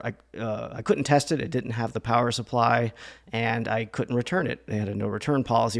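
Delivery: ordinary talk, nothing marked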